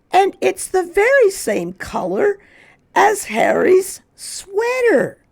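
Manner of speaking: put-on high, loud children's voice; children shouting. Children under the tree, loud and excited. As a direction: call it out together. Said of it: "they shouted together".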